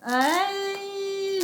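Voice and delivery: high, sustained little squeal; excited squeal. Real, not acted. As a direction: squeal as a thrilled fan. Fan excitement while unboxing. (petit cri aigu de fan)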